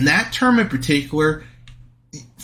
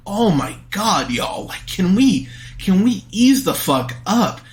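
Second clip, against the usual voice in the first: mock-exasperated plea, pitched up; fed-up, rising plea; exasperated reaction; 6 semitones higher than usual. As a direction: appeal to them in higher-pitched disbelief. Exasperated reply to the shaming woman.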